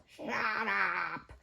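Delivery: mouse's drawn-out snarling noise. A narrator's reading. Mouse amid the shouting match, snarling. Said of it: "Mouse snarled".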